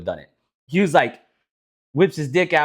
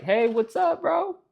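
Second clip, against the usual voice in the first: pitched up, mock-friendly bro banter; high goofy voices; 10 semitones higher, 4 dB quieter than usual. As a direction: ham up the two animals talking. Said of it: "the elephant's like".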